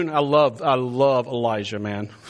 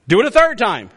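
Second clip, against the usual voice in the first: raised, emphatic order; commanding; 6 semitones higher, 6 dB louder than usual. Acted. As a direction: order it done, voice lifted. Prophet's command versus its narration.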